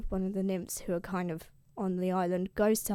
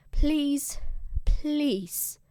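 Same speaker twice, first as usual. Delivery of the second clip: higher, begging please; pleading; 6 semitones higher than usual. Retold or imitated. Odysseus begs his crew to spare the cattle, pleading. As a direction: plead with them twice.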